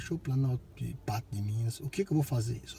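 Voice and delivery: ordinary delivery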